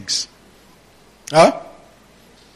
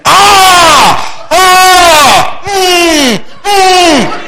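high, loud mocking exclamations; hammed-up cries of a know-it-all; mock triumphant yells; 14 semitones higher, 7 dB louder, much slower than usual. Mocking the ignorant quoter with high shouts. Mocking someone quoting without understanding.

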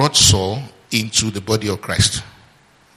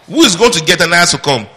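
raised, higher-pitched demand for an answer; insistent questioning; 6 semitones higher, 5 dB louder, faster than usual. Real, not acted. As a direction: press the congregation loudly for the answer. Presses congregation for an answer.

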